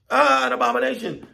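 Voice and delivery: very high cry of alarm; monkey screech. Acted. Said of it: "They screech".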